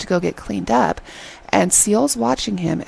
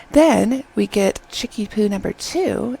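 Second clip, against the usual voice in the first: ordinary talk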